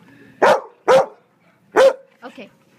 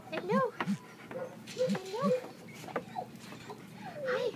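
cooing at a pet; 10 semitones higher, 8 dB quieter than usual. Real, not acted. Cooing hello at dogs.

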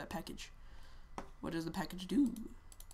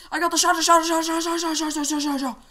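high, loud stuttering repetition; excited machine-gun babble; excited babbling; 9 semitones higher, 11 dB louder than usual. Real, not acted. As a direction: rattle the last word over and over, fading. Excitedly babbles name of new weapon.

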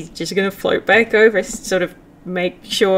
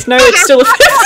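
loud, higher, laughing report; real laughter; 5 dB louder, faster than usual. Real, not acted. Player reading out a roll, laughing loudly. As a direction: laugh out the words.

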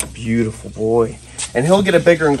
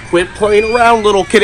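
loud scolding; 8 semitones higher, 8 dB louder than usual. Real, not acted. Scolding a kid nearby.

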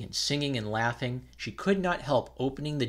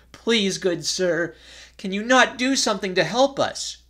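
desperate plea; 8 semitones higher, 8 dB louder than usual. Acted. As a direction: beg him for help through tears. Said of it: "their voice choked with sobs".

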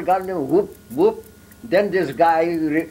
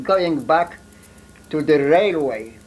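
normal talking voice

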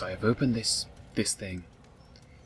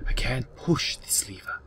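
frustrated whisper. Real, not acted.

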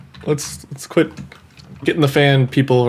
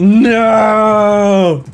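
higher, long wailed "no"; mock-despairing cry; 6 semitones higher, 4 dB louder than usual. Gamer lamenting the continuing curse, mock despair. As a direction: stretch it into a hammed-up lament.

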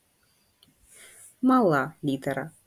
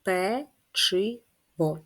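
slow, split into syllables; much slower than usual. Shown as a demonstration. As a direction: stretch печиво out slowly as пе-чи-во. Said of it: "Скільки складів має слово печиво?". (повільно, по складах)

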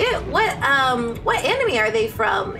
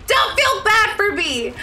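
playful shout; 6 semitones higher, slower than usual. Real, not acted. Shouting at teammates mid-game.

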